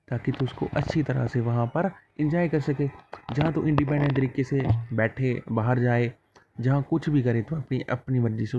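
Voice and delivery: normal talking voice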